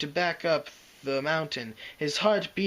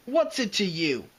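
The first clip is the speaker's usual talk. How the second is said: pugnacious; 6 semitones higher than usual. A narrator's reading. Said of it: "Dash said, pugnaciously".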